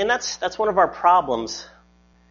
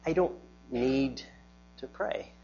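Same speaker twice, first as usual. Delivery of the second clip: quiet confession; 10 dB quieter than usual. Retold or imitated. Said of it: "I've said".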